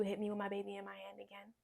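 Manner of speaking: hushed, defiant challenge under the breath; whispered retort. Retold or imitated. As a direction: whisper it as a dare. Defiant retort to angry partner.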